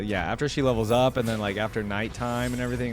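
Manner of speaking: ordinary delivery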